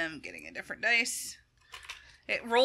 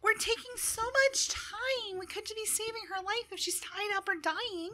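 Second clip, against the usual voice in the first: unhappy gnome; 5 dB quieter than usual. Acted. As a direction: fret in a small, high, softened gnome voice. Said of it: "a very unhappy gnomish voice".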